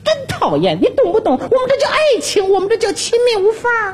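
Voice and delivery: put-on high, huffy young woman's voice; annoyed young woman. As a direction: snap at him like an annoyed girlfriend. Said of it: "那女同志一站起来看了我一眼".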